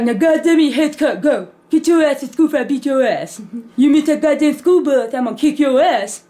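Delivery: hammed-up angry dad threats; threatening dad. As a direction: hurry her out with threats. Dad rushing kid to school.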